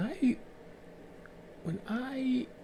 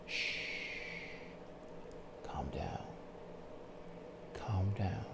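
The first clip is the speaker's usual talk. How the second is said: soft, softer-than-usual calming voice, almost whispered at first; soothing hush; 3 semitones lower, 11 dB quieter than usual. Shown as a demonstration. Calming a shouting woman with a soothing hush. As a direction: shush her gently, talk her down. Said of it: "a soothing voice will always pacify".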